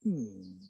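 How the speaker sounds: low, soft thinking "hmm"; pondering hum. Acted. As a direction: hum quietly as if deep in thought. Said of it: "And so he thought".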